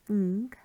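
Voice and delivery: Ng said alone as in "angle"; Welsh Ng sound. Shown as a demonstration. Teacher sounding out letters for learners, Ng as in angle. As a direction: hum out Ng as one nasal sound. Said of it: "as in angle".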